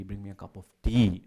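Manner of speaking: "tea" leaned on at the end; stress on TEA. Shown as a demonstration. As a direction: put the accent on "tea". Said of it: "where you put the accent".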